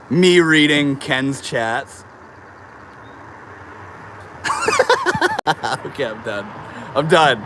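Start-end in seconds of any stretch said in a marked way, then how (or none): 4.3-5.8 a very high, squealing laugh falling away. Real, not acted. Streamer cracks up reading Ken's chats, squealing laugh.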